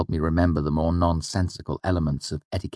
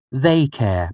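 firm, higher mother's voice; 7 semitones higher than usual. Acted. The mother firmly answering her son's complaint. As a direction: set it down plainly, no argument. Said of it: "my mother said firmly".

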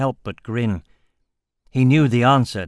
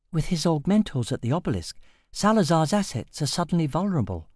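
put-on higher, keen young woman leaning in by the fire; Sasha's eager voice; 6 semitones higher than usual. Sasha urging the group at the fire, higher and eager. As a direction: voice her higher and hushed with excitement.

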